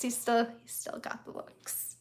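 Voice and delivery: a hushed, dreamy repeat; quiet aside. Real, not acted. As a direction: murmur it again as a fond aside.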